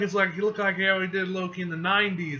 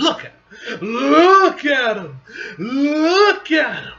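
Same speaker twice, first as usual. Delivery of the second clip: loud, drawn-out wail of admiration, climbing higher; over-the-top hype; 10 semitones higher, 13 dB louder, slower than usual. Real, not acted. Reader marveling at Null's look, wailing hype. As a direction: stretch it out slowly and louder with each repeat. Fan gushing over villain.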